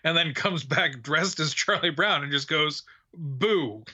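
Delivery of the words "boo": understated, deadpan mock scare; unamused boo. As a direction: give back the scare with little effort. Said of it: "walks away unamused".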